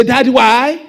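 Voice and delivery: put-on loud, demanding child's why; sharp, insistent Daddy, why; rude demand. Shown as a demonstration. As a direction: blurt it out as a pushy question. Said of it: "No, it's not why".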